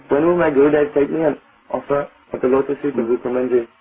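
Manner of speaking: ordinary talk, nothing marked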